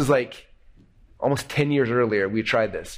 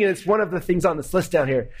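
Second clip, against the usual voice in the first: ordinary talk, nothing marked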